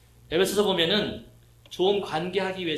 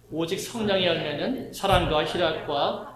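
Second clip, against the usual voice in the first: slow, louder congregational recitation; slow, louder group reading of scripture; 4 dB louder, slower than usual. Shown as a demonstration. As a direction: read it aloud together, measured. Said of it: "한번 같이 읽어보실까요? 시작".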